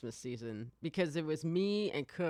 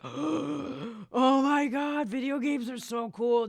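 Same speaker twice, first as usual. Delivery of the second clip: high, loud, hugely stretched fanboy gush; awestruck excitement; 10 semitones higher, 7 dB louder, slower than usual. Retold or imitated. Two friends learning who voiced their villain, awestruck gushing. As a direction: gasp it out in hammed-up amazement. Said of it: "Cook and I were just like".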